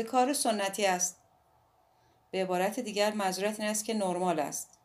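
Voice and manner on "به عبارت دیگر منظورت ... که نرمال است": ordinary delivery, nothing marked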